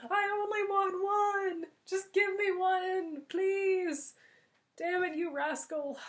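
hammed-up desperate begging; pleading shout. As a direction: plead loudly down the road.

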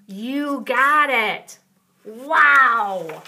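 slow, loud cheer; excited praise. Acted. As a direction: celebrate the child's answer. Praising child after answer.

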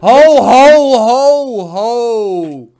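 very loud, drawn-out protest; calling a halt. Real, not acted. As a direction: shout to stop him, easing off by the last one. Cuts friend off to object.

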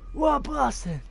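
mock outrage. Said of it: "you man was sitting there like".

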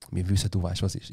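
hushed, conspiratorial uncle; imitated whisper. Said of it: "er so am Flüstern so".

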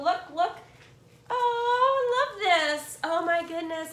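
excited gushing. Real, not acted. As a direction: gush over the gift like it's perfect. Unboxing a gift, gushing.

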